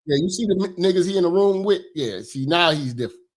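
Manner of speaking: ordinary delivery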